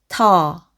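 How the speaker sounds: 터 drawn out on its own; sounding out. Shown as a demonstration. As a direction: sound out 터 as one held syllable. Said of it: "앞에서부터 읽어보면". (터를 길게 끌어 읽기)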